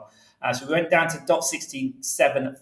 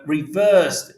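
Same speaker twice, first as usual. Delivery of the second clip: ordinary talk, nothing marked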